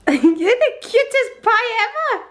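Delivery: high-pitched doting coo; delighted sing-song fussing. Real, not acted. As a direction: coo adoringly at a little one, voice way up. Gushing over the child.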